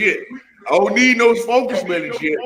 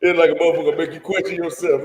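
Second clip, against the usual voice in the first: usual speaking voice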